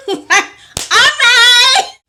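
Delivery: loud, high squeal with laughter; gleeful acceptance. Retold or imitated. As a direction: squeal a mock-eager yes.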